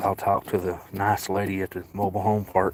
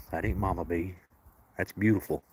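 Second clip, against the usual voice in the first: ordinary talk, nothing marked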